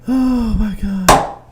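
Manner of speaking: slow, high, breathless laugh-cry; cracking up. Real, not acted. Cohost overwhelmed by laughter at the rant. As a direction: lose it laughing. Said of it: "I was about to die".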